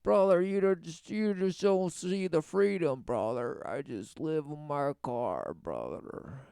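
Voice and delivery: low, soft laid-back bro voice; mocking drifter voice. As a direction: play a spaced-out drifter calling everyone brother. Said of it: "He's like".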